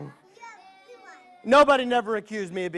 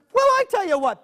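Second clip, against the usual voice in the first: ordinary delivery, nothing marked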